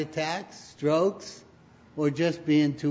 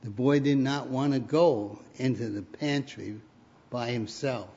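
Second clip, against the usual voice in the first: ordinary talk, nothing marked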